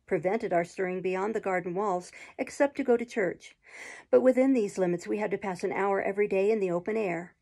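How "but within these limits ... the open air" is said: ordinary delivery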